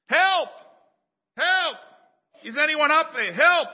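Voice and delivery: desperate high shout from a cliff; yelling for help. Acted. Jack hanging from a branch, shouting up for help. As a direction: scream up the cliff for rescue. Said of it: "So Jack began yelling for help".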